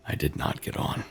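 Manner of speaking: usual speaking voice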